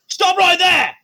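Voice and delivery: high, loud barked order; guard's shout. Acted. Drow guard shouting at Pippa to halt. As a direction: yell it to freeze them in place. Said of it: "he's also gonna".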